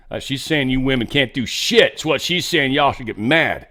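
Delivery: ordinary delivery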